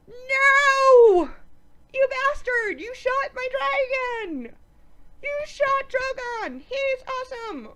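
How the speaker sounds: high, loud, drawn-out yell; outraged mock scream. Recapper rages over the shot dragon, hammed-up outraged scream.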